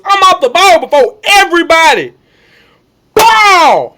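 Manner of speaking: high, loud re-enacted brag; shouted boast. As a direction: shout it, exploding into the hit. Re-enacts explosive start off ball.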